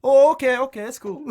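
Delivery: hammed-up relieved dad; mock relieved dad. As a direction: flip to cheerful approval like a placated father. Dad relieved it's a cop.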